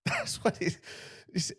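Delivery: words lost in a breathy laugh; can't finish the sentence for laughing. Real, not acted.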